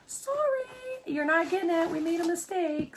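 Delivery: lightly voiced high, apologetic notice; hint of a high-pitched sorry-not-sorry; mock apology email. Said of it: "I got an email".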